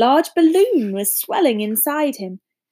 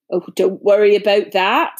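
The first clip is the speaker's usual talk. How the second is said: gruff, hearty giant voice; big friendly giant talking; Hagrid voice; 4 dB louder than usual. A narrator's reading.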